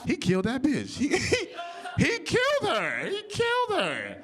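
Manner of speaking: high, drawn-out cry of disbelief; exaggerated realization. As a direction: wail the verdict, rising to a squeal. Acted realization about O J Simpson.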